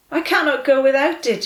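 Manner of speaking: distressed cry. A narrator's reading. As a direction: cry it out close to tears. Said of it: "he cried".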